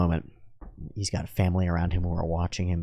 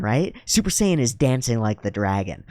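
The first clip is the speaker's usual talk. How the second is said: ordinary talk, nothing marked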